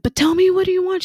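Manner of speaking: high, fast pleading question. Retold or imitated. Mom pressing her crying daughter, high and rushed. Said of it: "I was like".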